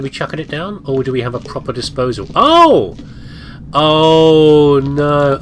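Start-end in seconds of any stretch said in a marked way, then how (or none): 2.3-5.4 loud, high startled oh no; startled cry. Real, not acted. Caught off guard by the game, a loud startled cry. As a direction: jump at the sudden shock.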